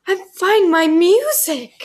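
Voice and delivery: high, slow, odd singsong delivery; strange Caddy voice. Retold or imitated. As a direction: drag it out high and oddly. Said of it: "speaks slightly strange".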